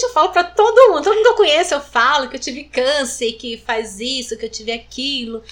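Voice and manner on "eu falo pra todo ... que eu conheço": higher and louder, insisting she tells everyone; proud, raised voice about speaking openly; declaring loudly that she tells everyone she knows; emphatic declaration. Real, not acted.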